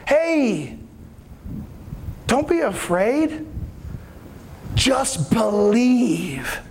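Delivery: slow, gentle comfort; a tender reassurance to a father; calm reassurance. Acted. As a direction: soothe him, take your time. Jesus reassures grieving father.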